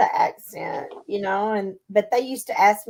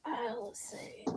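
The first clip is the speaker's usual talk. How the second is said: whispered aside; 2 semitones higher, 18 dB quieter than usual. Real, not acted. Checking the video call quietly.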